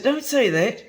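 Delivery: a hint of an annoyed girlfriend; girlfriend's retort. Quoting girlfriend telling him off, mock annoyed. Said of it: "She said".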